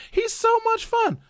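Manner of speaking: high, slow gushing parent voice. Retold or imitated. Parents praising him when the kids come home, high and gushing. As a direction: coo it like a delighted parent.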